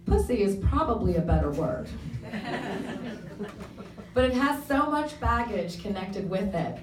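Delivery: measured, lightly theatrical reading voice; monologue reading. Acted. Reader performing the preface to the audience, measured and earnest. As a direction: read it to the room as a performed confession.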